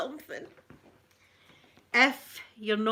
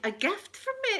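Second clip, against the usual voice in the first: surprised; 11 semitones higher than usual. Retold or imitated. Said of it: "And I said".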